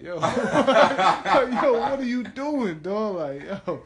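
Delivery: laughing. Real, not acted. Mocking people's dirty teeth.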